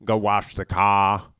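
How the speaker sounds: mock Boston accent, loud and slow. Boston accent, mocking what his girl doesn't say. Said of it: "that Boston thing".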